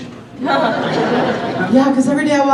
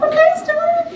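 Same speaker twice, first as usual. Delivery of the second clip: much higher, sing-song "Okay, Stuart"; high mock agreement. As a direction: chirp it like a too-eager yes. Her reply to Stuart.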